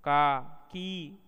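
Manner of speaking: का and की drawn out slowly with long vowels; slow, elongated का and की. Shown as a demonstration. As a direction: stretch का and की to a full long vowel. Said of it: "इनकी मात्रा में युक्त व्यंजन".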